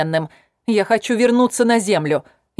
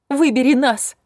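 desperate cry; 7 semitones higher than usual. A narrator's reading. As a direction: beg her with everything. Eva pleads, louder.

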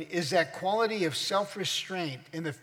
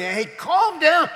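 high, loud, slow mocking "Hey, calm down"; 12 semitones higher, 11 dB louder, slower than usual. Preacher mocks someone telling him to calm down, loud and high. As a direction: sneer it like someone scolding you to calm down.

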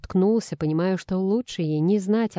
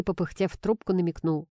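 plain reading voice

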